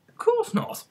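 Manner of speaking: put-on very high girlish voice; high eager girl; Alice's quick reply. A narrator's reading. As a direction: answer readily, very high. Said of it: "Alice replied very readily".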